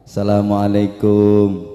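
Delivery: slow, low salam at the door; visitor's greeting. Shown as a demonstration. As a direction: call slowly and low to the household. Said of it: "intonasinya udah beda. Ketuk pintu".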